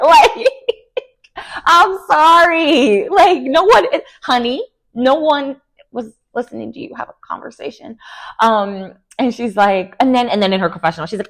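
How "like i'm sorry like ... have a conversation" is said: exasperated. Real, not acted. Outraged at the webcam story.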